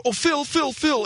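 high, breathless fan calling out; adoring fan squeal. Pretend. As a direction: squeal his name like a starstruck fan. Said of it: "Please wave to me and go".

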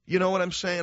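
ordinary talk

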